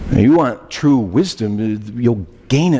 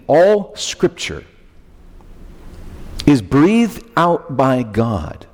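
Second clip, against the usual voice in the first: slow, solemn scripture reading; 4 semitones higher, slower than usual. Acted. Pastor reads the key verse.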